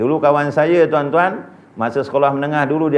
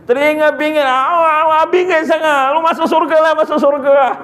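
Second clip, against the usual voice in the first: mock annoyed angel; 10 semitones higher, 3 dB louder than usual. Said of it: "kata dia".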